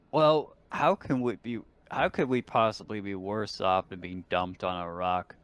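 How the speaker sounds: normal talking voice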